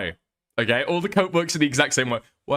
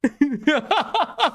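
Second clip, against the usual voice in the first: high laugh; 13 semitones higher than usual. Real, not acted. Laughs after Queeman impression.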